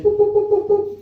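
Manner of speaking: high beeping keypad tones; keypad beeps. Retold or imitated. Clerk punching in the bathroom code, high beeping. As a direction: beep each key of the door code.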